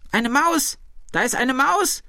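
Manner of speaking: very high-pitched cry of alarm; shrieked alarm. A narrator's reading. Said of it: "schrie die alte Frau". (hoher, erschrockener Aufschrei)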